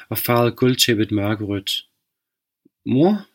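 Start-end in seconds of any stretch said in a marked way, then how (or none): 2.9-3.3 higher, frightened little call; stammered. Acted. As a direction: stammer it out, scared. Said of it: "fik hun fremstammet". (fremstammet, bange)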